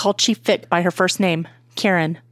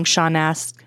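ordinary delivery

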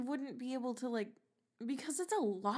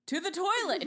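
excited exclaim; 7 semitones higher, 4 dB louder than usual. Pretend.

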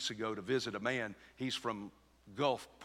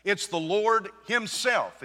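slow, raised "Lord himself"; emphatic stress; 7 semitones higher, 7 dB louder, slower than usual. Shown as a demonstration. Preacher explains the emphatic Greek, stressing "Lord himself". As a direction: drive home "Lord himself", drawn out and higher. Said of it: "It's emphatic in the Greek".

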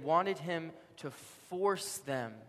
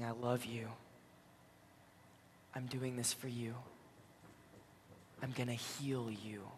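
tender reassurance; 5 dB quieter than usual. Retold or imitated. Jesus beside the dying criminal, tenderly reassuring. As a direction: speak gently beneath the shouting.

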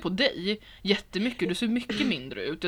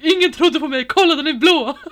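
loud, high triumphant exclaiming; excited shout; 9 semitones higher, 11 dB louder than usual. Retold or imitated. Showing everyone the bruise, loud and high triumph. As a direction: shout it like finally proven right. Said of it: "Jag bara".

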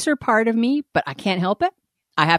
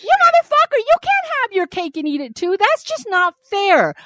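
much higher, half-shrieked indignation; pitched-up mock outrage at the boyfriend; indignant outburst; 11 semitones higher than usual. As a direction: shriek the outrage at him in a squeaky voice.

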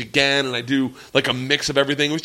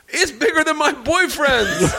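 mock girl voice; 10 semitones higher than usual. Imitating girl.

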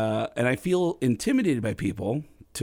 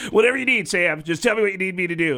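Tom Arnold voice; 6 semitones higher, 5 dB louder than usual. Retold or imitated. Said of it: "And he goes".

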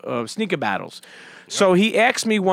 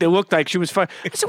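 normal talking voice

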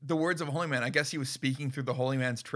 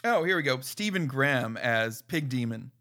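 ordinary delivery

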